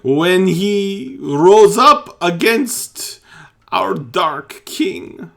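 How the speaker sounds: loud, high, anguished telling; anguished account. Acted. Priest recounting his son's beating, loud and anguished. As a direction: force the story out in pain.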